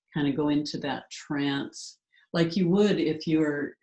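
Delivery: ordinary delivery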